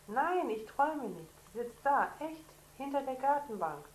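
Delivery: high, loud protest; insistent excitement. Acted. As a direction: insist she believe you. Boy insists to sister. (Jonas besteht aufgeregt darauf, laut und hoch)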